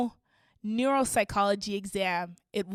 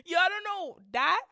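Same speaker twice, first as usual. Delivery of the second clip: ordinary delivery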